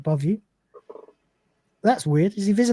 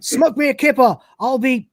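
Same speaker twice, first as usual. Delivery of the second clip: heroic cry; 7 semitones higher, 4 dB louder than usual. Acted. Ace's heroic catchphrase.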